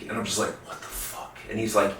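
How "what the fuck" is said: barely voiced, retold disbelief; stunned whisper. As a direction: whisper it as if frozen in shock. Stunned reaction to examiner's warning.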